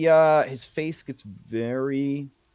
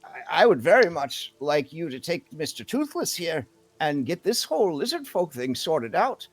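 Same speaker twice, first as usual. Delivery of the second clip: higher, emphatic elder's voice; outspoken elder townsperson; 7 semitones higher, 5 dB louder than usual. Acted. Elder asks them to sort out the lizard folk, higher emphatic voice. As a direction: speak up frankly, pitch swooping upward.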